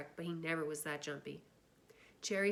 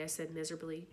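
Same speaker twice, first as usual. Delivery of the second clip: plain reading voice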